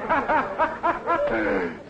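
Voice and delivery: a high, loose tipsy laugh; drunken laugh. Acted. Drunk man laughing at his dance partner. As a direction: laugh like the party's all yours.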